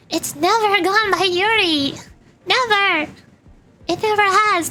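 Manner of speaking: high, insistent denial, stressing "never"; emphatic denial. Real, not acted. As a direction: hit "never" hard, pitched well up. Streamer emphatically corrects a viewer.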